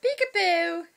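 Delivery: high, loud singsong burst; playful peekaboo. Real, not acted. Mum making baby giggle, high excited peekaboo.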